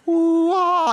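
high, drawn-out mock whoosh; playful "ooh, ah". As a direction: swoop high on a teasing ooh-ah. Playfully acting God's showy self-giving.

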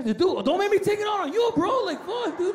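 put-on high, whiny kid on the verge of tears; mock crying, threatening his brother; tearful frustration. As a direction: protest in a hammed-up high wail. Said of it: "Are you crying?".

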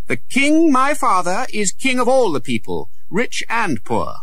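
high, loud royal declaration; a prince reminding the guard of his rank; proud young prince. Acted. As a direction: lay down the law like a boy prince. Prince asserts royal authority.

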